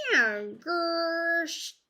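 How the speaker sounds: high, loud meow and growl; cat noises. Acted. Cat Simpkin growling at the sleeping tailor, high loud meow. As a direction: snarl like a cross cat. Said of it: "made strange, horrid noises".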